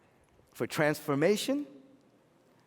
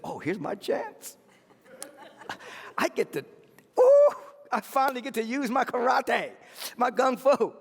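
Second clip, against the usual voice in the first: gleeful, higher and louder by the end; eager inner voice; 8 semitones higher, 3 dB louder than usual. Acted. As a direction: relish it like a long-awaited chance. Voices martial artist's eager thoughts.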